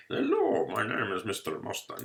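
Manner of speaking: squeaky high mock introduction; a silly cartoon mustache character says hello; mustache man voice. Teacher voicing a doodle with a mustache, high and silly.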